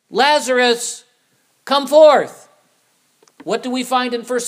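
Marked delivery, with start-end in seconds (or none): 0.1-2.5 loud commanding call. Acted. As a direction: call the dead man out with full authority. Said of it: "spoke with a loud voice".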